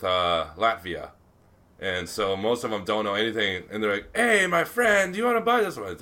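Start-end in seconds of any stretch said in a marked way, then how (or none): 4.2-6.0 high, loud hawker's call; a vendor calling out to a tourist, pitched high; pushy street vendor. Retold or imitated. Vendor hawking souvenirs to a tourist, loud and high.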